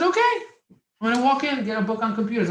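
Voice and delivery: sudden burst of eagerness; eager okay. Retold or imitated. Teen finally agrees to go into the library, fast and eager.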